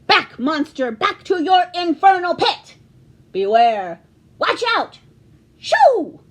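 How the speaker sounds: loud, rising hero shouts; heroic catchphrases. Acted. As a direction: bellow to scare off monsters. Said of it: "tried out some catchphrases".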